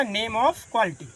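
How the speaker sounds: slow, words drawn out; slow dictation. Shown as a demonstration. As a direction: dictate clearly for notes. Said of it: "teacher dictating heading". (धीमे-धीमे लिखवाना)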